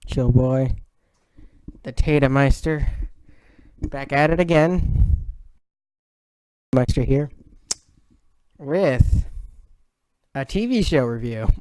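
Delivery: YouTube intro. Acted. Channel intro greeting.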